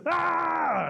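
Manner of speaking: loud, high, held shriek; startled scream. Acted. Chief seeing Possum lunge to kiss him, screaming. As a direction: yell out in comic alarm.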